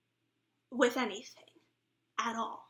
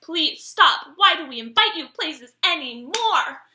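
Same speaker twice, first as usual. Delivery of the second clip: loud, raised-pitch complaint; exasperated plea; 4 semitones higher, 10 dB louder, slower than usual. Retold or imitated. Friends fed up with the party singer, exasperated. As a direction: beg loudly, at the end of patience.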